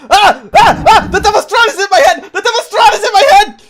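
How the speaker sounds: high, loud terrified shrieks; panicked screaming. Acted. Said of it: "he freaks the fuck out".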